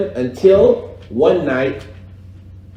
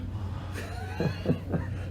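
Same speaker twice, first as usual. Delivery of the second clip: laugh; 15 dB quieter than usual. Real, not acted. Laughing at chanted small talk.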